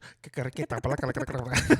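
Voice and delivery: rapid made-up Spanish gibberish; fake foreign language. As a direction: rattle off fake Spanish sounds. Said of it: "I can speak Spanish".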